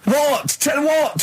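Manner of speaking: high baffled disbelief; genuinely baffled, pitch flying up; incredulous. Real, not acted. Baffled at Carl's answer.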